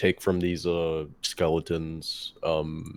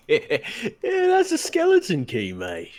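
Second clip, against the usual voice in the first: cheeky mock voice; 4 dB louder than usual. Pun answer, mock accent.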